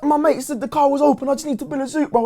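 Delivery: high-pitched imitation of a frightened man; mock terrified pleading; panicked apology. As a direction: plead like a scared intruder caught out.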